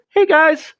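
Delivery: lightly playing Elon Musk, waving to supporters; a hint of Elon Musk doing his wave. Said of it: "he did the little Elon Musk wave".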